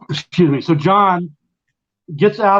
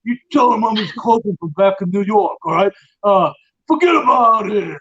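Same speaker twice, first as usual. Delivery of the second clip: higher-pitched mock New York mobster; 5 semitones higher than usual. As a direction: do a hammed-up New York Italian wiseguy. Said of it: "it's a stereotypical, uh, accent".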